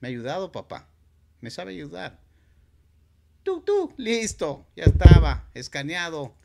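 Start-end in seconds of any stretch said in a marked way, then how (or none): 3.4-3.9 high loud mock beep; mock scanner success noise.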